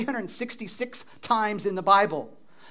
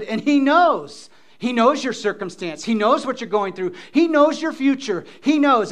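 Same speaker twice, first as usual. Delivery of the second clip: rising, louder, emphatic He knows; preaching build; 3 semitones higher, 6 dB louder than usual. Real, not acted. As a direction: hammer it home to the congregation.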